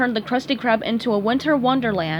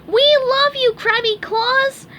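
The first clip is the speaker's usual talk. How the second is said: high, loud delighted cheer; children shouting; 13 semitones higher, 5 dB louder, slower than usual. Acted. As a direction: cheer like a crowd of happy kids. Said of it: "the children shouted with delight".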